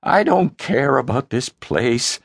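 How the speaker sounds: high, slow desperate protest; anguished son's high complaint; voice cracking. Acted. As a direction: wail it out, pitched high. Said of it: "His voice cracked".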